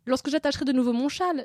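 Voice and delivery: hammed-up royal lament; moaning. A narrator's reading. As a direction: moan it pitifully. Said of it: "gémit la pauvre reine".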